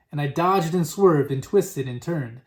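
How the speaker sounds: ordinary delivery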